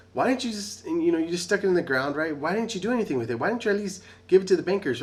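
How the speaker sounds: high, loud exasperated scolding; the master berating the lazy servant, high and loud. Retold or imitated. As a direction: scold the servant like a fed-up boss. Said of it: "scolding him".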